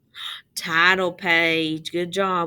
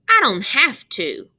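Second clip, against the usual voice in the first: defiant retort; 5 semitones higher, 8 dB louder than usual. A narrator's reading. Russell defiant reply.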